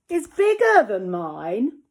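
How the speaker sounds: loud, slow, cross complaint. Acted. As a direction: grumble it out crossly. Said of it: "thought Dog crossly".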